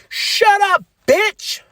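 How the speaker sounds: high, loud mocking snap; mock shout. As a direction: fling the insult back at her. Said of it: "Jade and Jordan goes".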